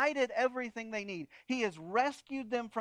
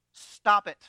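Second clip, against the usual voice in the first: firm command; 11 dB louder than usual. Shown as a demonstration. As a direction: Stop it, said much louder and firm. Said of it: "stand up to the rabble and say".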